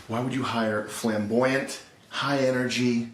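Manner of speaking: ordinary talk, nothing marked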